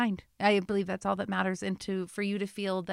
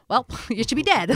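higher, louder quote with a laugh; 4 semitones higher, 7 dB louder than usual. Retold or imitated. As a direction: laugh through the quoted line.

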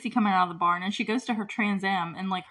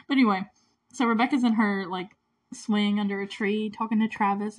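ordinary talk, nothing marked

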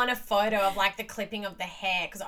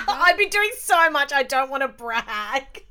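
sheepish pride; 6 semitones higher, 7 dB louder, slower than usual. Pretend. Mock bragging, laughing.

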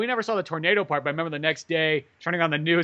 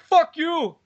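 angry local; 11 semitones higher, 4 dB louder than usual. Retold or imitated. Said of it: "probably the local guy's like".

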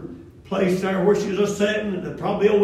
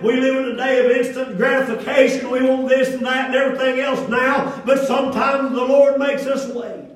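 rising, louder preaching cadence; 8 semitones higher, 8 dB louder than usual. Acted. Pastor exhorting the congregation, emphatic preaching.

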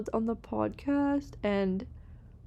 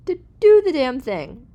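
rising, louder and higher, pumped-up push; excited push; 5 semitones higher, 10 dB louder than usual. Real, not acted. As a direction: fire them up to do the damn thing. Pep talk climax, loud.

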